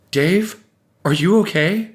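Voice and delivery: high, anxious call; concerned, high-pitched question; worried wife. A narrator's reading. Worried wife calls to dazed husband.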